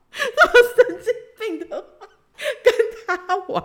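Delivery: squeals it out high through laughter; laughing. Real, not acted. To listeners, laughing at her own outrageous words. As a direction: laugh while saying it.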